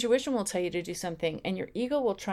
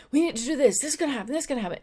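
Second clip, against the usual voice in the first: higher and louder, rushed alarm; panicky ego voice; 6 semitones higher, 4 dB louder, much faster than usual. Retold or imitated. The ego panicking over a crisis, anxious and raised. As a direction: sound like someone hitting the panic button. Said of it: "It's like this panic button".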